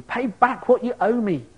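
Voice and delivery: a servant grabbing and choking his debtor, demanding angrily; angry demand. Acted. Servant throttling a fellow servant, barking an angry demand.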